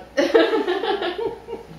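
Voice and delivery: high giggle; short giggle; real laughter. Real, not acted. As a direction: crack up. Breaks up mid reading.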